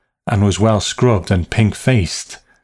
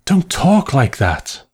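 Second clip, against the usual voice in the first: higher, slower anxious plea; trembling; 6 semitones higher than usual. A narrator's reading. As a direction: plead as if trembling.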